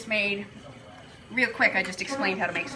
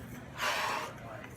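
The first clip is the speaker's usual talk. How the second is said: tired sigh; 12 semitones lower, 10 dB quieter than usual. Real, not acted. Sighs after finishing the recipe.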